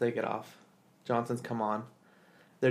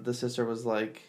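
ordinary talk

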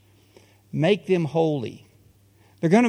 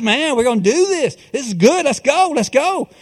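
pitched-up rallying cry, Let's go; fired-up disciples; 6 semitones higher than usual. Retold or imitated. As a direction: pump up the crowd like eager disciples. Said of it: "They're fired up".

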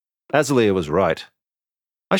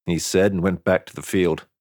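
ordinary delivery

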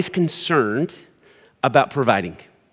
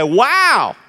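high, loud, amazed wow; impressed wow; 11 semitones higher, 9 dB louder than usual. Shown as a demonstration. Spouse answering the dreamer's idea, high amazed wow. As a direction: gasp it out in delight. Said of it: "You need to say".

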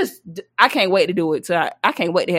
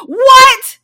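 much higher, shouted disbelief; outraged shout; 12 semitones higher, 8 dB louder than usual. Retold or imitated. Daughter shouting back at mama over water, outraged. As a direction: blow up in mock outrage.

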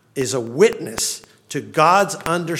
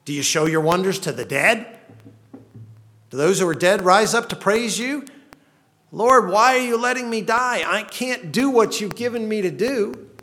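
rising, louder pleading questions thrown at God; desperate accusation; 7 semitones higher, 4 dB louder than usual. Acted. Psalmist accusing God of letting him die, loud desperate questions. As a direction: argue with God as if dying.

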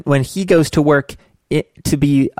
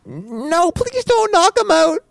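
put-on kid voice; 7 semitones higher than usual. Kid character talks to dad.

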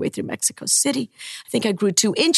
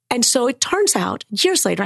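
ordinary talk

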